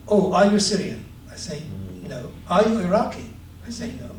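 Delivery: usual speaking voice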